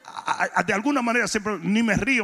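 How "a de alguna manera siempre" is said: ordinary delivery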